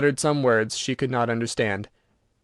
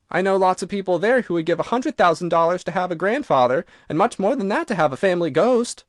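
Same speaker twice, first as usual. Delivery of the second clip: put-on lightly raised girlish voice for Virginia; a hint of an eager young girl, higher; 6 semitones higher than usual. A narrator's reading. Virginia coaxing the ghost to come to America, lightly girlish.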